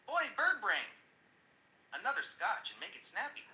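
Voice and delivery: bossy parrot demand, starting high; yelling parrot. Acted. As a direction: call out rudely as the parrot. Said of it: "the parrot yells".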